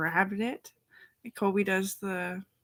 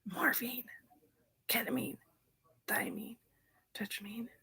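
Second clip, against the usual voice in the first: breathy whisper, word by word; whispered list; 5 semitones higher, 4 dB quieter than usual. Real, not acted. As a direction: whisper the names like a secret. Listing drugs under her breath.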